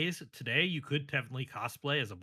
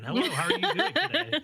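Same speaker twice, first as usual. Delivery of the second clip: much higher chirpy greeting; high sweet voice; 13 semitones higher, 6 dB louder than usual. Pretend. Joke after gender quip.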